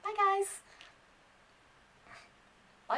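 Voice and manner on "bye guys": high, bright-voiced bye guys; mock YouTuber goodbye, pitched way up; basic YouTuber sign-off. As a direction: chirp it like a cheesy YouTuber outro. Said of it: "be quite basic and be like".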